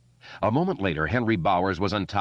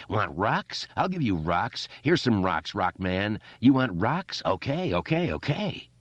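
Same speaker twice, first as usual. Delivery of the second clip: crazed, gleeful torment; raving bully; 3 semitones higher than usual. Acted. Henry raving.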